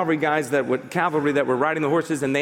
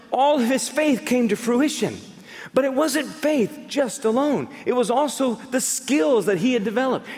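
preaching at full force; 6 semitones higher than usual. Real, not acted. Preacher stirring the congregation to trust God. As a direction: lift the crowd with rising fervor.

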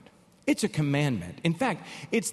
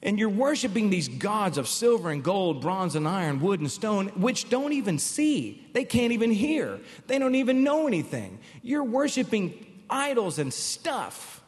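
preached rebuke; 4 semitones higher than usual. Acted.

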